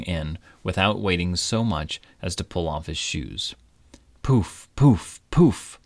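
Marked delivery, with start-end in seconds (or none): 4.2-5.8 high, loud spluttering snorts; high, loud splutter out of the river; snorting. Acted. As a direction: snort water out as you surface. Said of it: "snorted King Midas".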